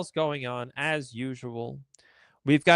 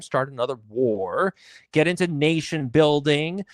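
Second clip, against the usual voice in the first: ordinary talk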